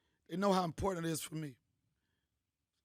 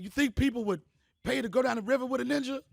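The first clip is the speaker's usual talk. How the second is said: excited; 6 semitones higher, 6 dB louder than usual. Retold or imitated. Excited retelling of his plan.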